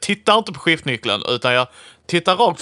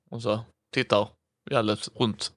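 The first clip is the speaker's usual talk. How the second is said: ordinary delivery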